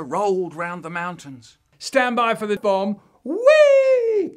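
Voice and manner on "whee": very high loud whee. Acted. Jumping in like a bomb, a loud high whee. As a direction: let it fly out.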